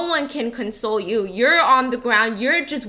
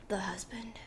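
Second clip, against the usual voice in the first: hushed whisper; 4 semitones lower, 15 dB quieter than usual. Retold or imitated. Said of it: "so he goes".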